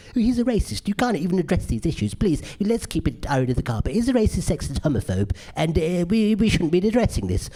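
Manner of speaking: high-pitched mocking critic voice; left-wing critic. Critics objecting to the comic's racial material, mocking high voice. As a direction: send up a fussy, disapproving critic. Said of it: "these left-wing critics say".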